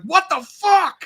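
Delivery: high, loud, drawn-out imitation of outrage; mock pissed-off yell. Imitating someone mad after a night terror, hammed-up angry shout. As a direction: play up the angry disbelief, stretching it out. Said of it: "you get fucking pissed".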